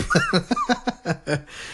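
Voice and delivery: breathy high giggle; high laugh. Real, not acted. Laughing at own tangent.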